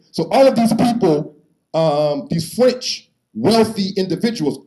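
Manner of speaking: normal talking voice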